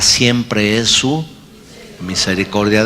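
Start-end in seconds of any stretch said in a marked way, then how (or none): none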